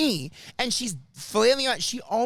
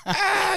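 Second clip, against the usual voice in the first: high, loud mock "ah" of outrage; mock scream; 13 semitones higher, 5 dB louder than usual. Imitating a listener hearing all the stories, mock scream. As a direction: burst out with an exasperated high cry.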